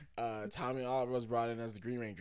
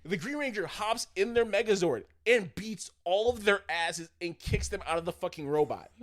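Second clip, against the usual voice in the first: high, shouted, worked-up insistence; really loud; 8 semitones higher, 8 dB louder than usual. Real, not acted. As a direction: yell it as if they must believe you. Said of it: "I'm getting really loud into it".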